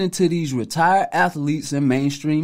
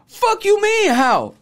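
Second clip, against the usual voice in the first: mock outrage; 12 semitones higher, slower than usual. Mocking athletes' outraged reaction.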